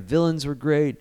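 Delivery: ordinary delivery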